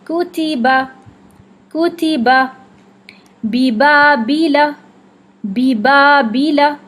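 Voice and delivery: firm, tight ب in کتیبہ and بیبا, slow; Quranic recitation. Shown as a demonstration. Teacher reciting sample words for students to repeat. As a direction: recite each word twice with a pressed, airless ب. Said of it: "با کو شدہ کے ساتھ سختی سے ادا".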